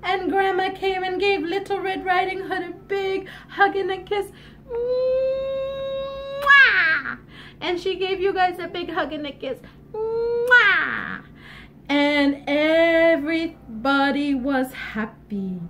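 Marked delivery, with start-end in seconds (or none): 4.7-7.2 very long, high mmm-wah; exaggerated storybook kiss sound; huge kiss noise. Acts out Grandma's big kiss. 9.9-11.2 high hummed mmm-wah; exaggerated kiss sound; big kiss noise.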